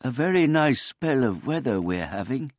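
put-on high, polite old man voice; a gentle, childlike old man; simple old man. A narrator's reading. Old man greets the cat.

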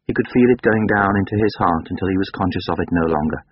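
usual speaking voice

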